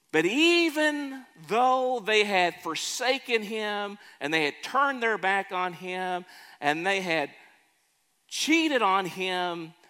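slow, swelling preacher's voice; preaching. Acted. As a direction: lean into it like a pulpit sermon. Preacher on Israel's betrayal.

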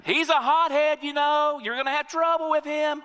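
high, loud mock heckle; a heckler warns about the hothead; shouted warning from crowd. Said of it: "Somebody shouts out".

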